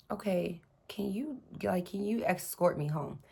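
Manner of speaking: quiet, hesitant asking; timid soft plea. Retold or imitated. Scared driver asking the officer for an escort, softly. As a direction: ask softly, unsure he'll help.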